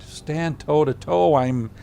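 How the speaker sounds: usual speaking voice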